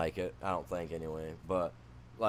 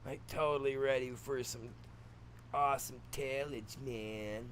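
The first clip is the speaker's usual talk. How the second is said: higher, put-on surfer dude voice; surfer boy talk; 4 semitones higher, 4 dB quieter, slower than usual. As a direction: play a stoked surfer with drawn-out words. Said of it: "Switch up to some surfer boy talk".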